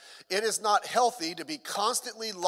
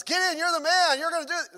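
higher, louder cheerful call; rally him like an eager coach; lightly voiced God hyping Gideon; 8 semitones higher, 3 dB louder than usual. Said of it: "God says".

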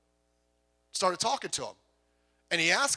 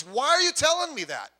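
higher, louder, baffled challenge; soldier's exasperated demand; 9 semitones higher, 6 dB louder than usual. Retold or imitated. Soldier confronting the honest prisoner, higher and louder in disbelief. Soldier voiced in a retold story.

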